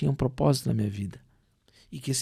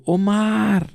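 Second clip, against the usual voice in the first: imitated far-off call, loud and higher; chamando de longe; 6 semitones higher, 7 dB louder than usual. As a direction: call the name out across a square. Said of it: "eu escuto uma voz cham-, dizendo". (chamado alto imitado, como de longe)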